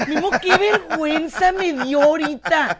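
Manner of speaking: high, loud laughing cringe; laughing embarrassment. Real, not acted. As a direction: laugh while saying how embarrassing.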